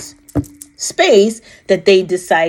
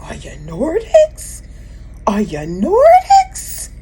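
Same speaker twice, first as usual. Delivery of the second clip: slow eager question, climbing much higher on the repeat; excited question; 7 semitones higher, slower than usual. Retold or imitated. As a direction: ask it breathless with wonder, pitch jumping up. Retelling asking the being.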